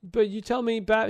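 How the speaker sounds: mock hurt kid brother protest; upset little brother. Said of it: "he's all upset".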